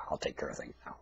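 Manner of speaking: hushed and soft; whisper. Real, not acted. Ranter reassures Bush, soft whisper. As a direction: drop to a secret whisper. Sudden whisper after shouting.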